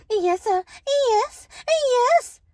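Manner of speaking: very high, loud, exaggerated compliance; mock obedience. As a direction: chirp it high and loud, parodying dutiful submission. Mocking expected obedient answer to parent.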